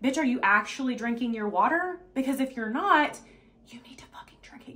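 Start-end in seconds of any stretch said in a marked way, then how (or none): 3.7-4.7 hushed, voiceless aside; whisper. Real, not acted. Coach telling listener to drink water, whispering. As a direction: whisper it like a blunt secret. Confides the blunt truth in a whisper.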